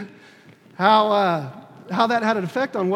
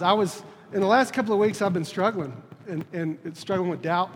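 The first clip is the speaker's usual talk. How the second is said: normal talking voice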